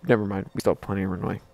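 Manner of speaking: ordinary talk, nothing marked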